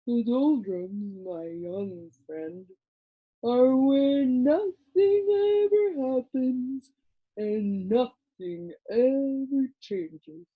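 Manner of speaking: slow, drowsy creature drawl; a dull little creature explaining to Milo, slow and sleepy; sleepy Lithargian. A narrator's reading. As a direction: drone it lazily like a half-asleep creature.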